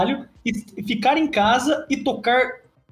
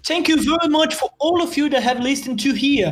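higher, polite British thank-you; a hammed-up English host thanking listeners; mock British accent; 3 semitones higher than usual. Mock British accent thanking podcast listeners. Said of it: "sotaque britânico".

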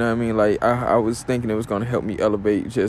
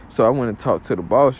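ordinary talk, nothing marked

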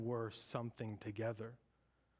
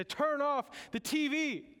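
much higher, louder, slow pleading whine; whiny prophets; 12 semitones higher, 5 dB louder, slower than usual. Retold or imitated. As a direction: drag it out like a desperate prophet begging. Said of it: "They say".

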